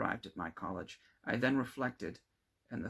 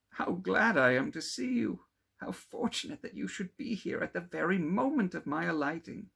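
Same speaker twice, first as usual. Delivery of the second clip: much higher and louder, bubbling with delight; overjoyed exclaiming; 9 semitones higher, 7 dB louder than usual. Acted. Clerval overjoyed to find his friend at the inn. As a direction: gush with happiness at the lucky meeting. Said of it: "exclaimed he".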